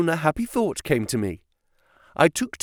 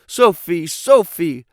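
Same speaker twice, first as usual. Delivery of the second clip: restrained narrator's scream, raised and louder; screamed name; 4 semitones higher, 6 dB louder, slower than usual. A narrator's reading. Freed captive crying out to the woman, a contained scream. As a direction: cry out the name twice, higher and louder. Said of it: "and screaming out".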